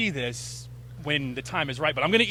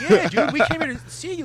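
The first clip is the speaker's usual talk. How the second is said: a higher, louder, laughing retold cheer; excited fans; 10 semitones higher, 10 dB louder than usual. Fans at the rail cheering up a nervous comedian, laughing and eager. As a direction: gush at him like eager fans. Fans retell reassuring Jamie Kennedy.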